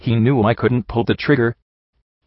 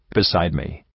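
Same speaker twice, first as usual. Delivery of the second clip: usual speaking voice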